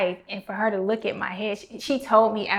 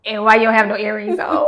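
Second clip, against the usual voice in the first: scolding mom; 10 dB louder than usual. Retold or imitated. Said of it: "My mom will fuss at me".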